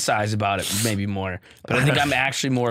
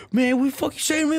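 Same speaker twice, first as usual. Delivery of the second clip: high, fast imitation of a yelling driver; angry old driver; 14 semitones higher, faster than usual. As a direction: fire it back like the furious cabbie. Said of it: "I was talking sh-, like, like that".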